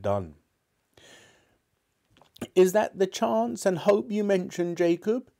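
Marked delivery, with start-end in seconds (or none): 2.5-5.3 high, wavering frightened voice; faltering Scrooge. A narrator's reading. Scrooge asks the ghost about the chance, high and faltering. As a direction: demand it while your voice falters. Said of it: "he demanded in a faltering voice".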